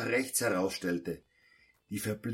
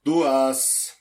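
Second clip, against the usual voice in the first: correcting call; 2 semitones higher, 7 dB louder than usual. Acted. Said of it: "korrigierte mich Pedro".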